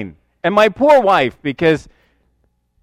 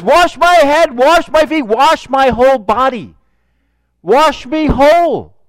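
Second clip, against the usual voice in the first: louder, higher, urgent pleading; eager Peter; 5 semitones higher, 5 dB louder than usual. Retold or imitated. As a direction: beg to be washed all over. Peter eagerly asks for washing.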